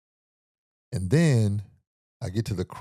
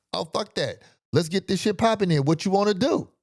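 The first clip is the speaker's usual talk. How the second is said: high, hammed-up tough-guy challenge; mocking aggressive stranger; 9 semitones higher than usual. Stranger squaring up on the street, high mock challenge. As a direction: puff up like a crazy man picking a fight. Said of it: "This how he comes off".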